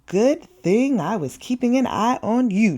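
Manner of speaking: usual speaking voice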